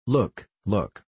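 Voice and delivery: mildly excited cry to look; fresh excitement. A narrator's reading. Heidi draws Peter's eyes to the mountains, excited. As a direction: exclaim with a touch of wonder. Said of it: "cried Heidi in fresh excitement".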